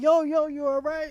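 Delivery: high, loud mock-panicked check on him; alarmed concern. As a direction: imitate onlookers calling out in alarm. Voices worried onlookers, man collapsed.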